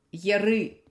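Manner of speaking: ordinary talk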